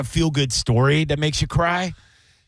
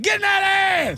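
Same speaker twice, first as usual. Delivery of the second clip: mock hype shout; faster than usual. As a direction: yell it like a fired-up fan. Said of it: "you're like".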